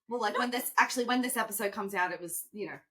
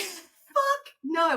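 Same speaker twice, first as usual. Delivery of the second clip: emphatic; 6 semitones higher, 4 dB louder than usual. Real, not acted. Repeated emphatic refusal.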